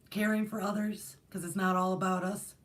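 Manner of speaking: usual speaking voice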